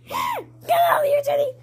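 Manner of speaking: very high, squealed telling-off; high-pitched shooing. Real, not acted. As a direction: shoo away in a high squeal. Shooing Teddy away.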